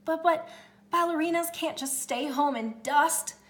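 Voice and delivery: high, loud shocked gasp. Acted. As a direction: gasp in dismay. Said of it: "gasped Angelina".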